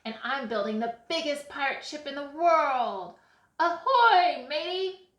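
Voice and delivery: loud boast rising to a high hail; brag, then call out like a pirate; bragging. A narrator's reading. Boy boasting about his pirate ship, bragging. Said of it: "Alex bragged".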